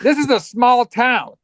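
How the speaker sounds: high, drawn-out protest; mock protesting cop. Cop excusing himself over small-town trouble, mock protesting.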